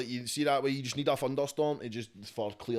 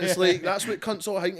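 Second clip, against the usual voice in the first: laughing; 7 semitones higher, 9 dB louder than usual. Real, not acted. Still laughing at stereotype.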